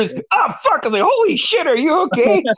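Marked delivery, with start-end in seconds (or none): 0.3-0.8 high-pitched retold cry of dismay; imitated crash yelp. Friend going over the handlebars, high imitated yelp. 1.0-2.4 very high, startled exclamation; retold alarm. To a friend who flipped his bike, high retold alarm. Retells alarmed reaction to crash.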